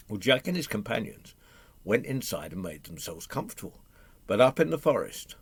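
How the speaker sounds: ordinary delivery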